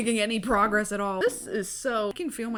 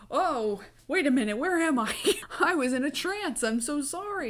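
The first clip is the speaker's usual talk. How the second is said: higher, mock-innocent dazed voice; pretend confused; 6 semitones higher than usual. Voldemort's followers faking confusion after his fall, higher. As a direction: play dumb as if waking from a trance.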